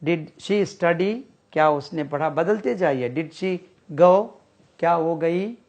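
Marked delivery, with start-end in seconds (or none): 0.0-1.2 slow, drawn-out question with a rise; slow classroom model of did she study; slow teaching read. Shown as a demonstration. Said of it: "देखिए". 3.1-4.3 slow, clearly rising question; slow classroom demo with a rising go; slow model question. Shown as a demonstration. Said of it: "देखिए".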